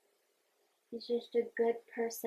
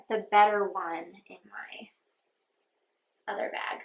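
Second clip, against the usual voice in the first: whispered aside; 3 semitones lower, 4 dB louder than usual. Real, not acted. Whispering to herself.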